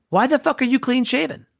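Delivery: high-pitched, exasperated challenge; mock outraged demand. Showrunner confronting actor in makeup room, imitated outrage. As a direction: imitate an irate boss demanding answers.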